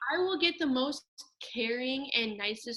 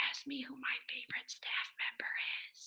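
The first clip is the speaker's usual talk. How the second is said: whispered, no voice at all; 11 dB quieter than usual. Real, not acted. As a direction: breathe it out as a secret aside. Sneaky prompt to her friend.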